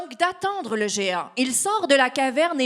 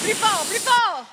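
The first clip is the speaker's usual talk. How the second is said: urging on; 9 semitones higher than usual. Real, not acted. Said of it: "démonstration du souffle du géant".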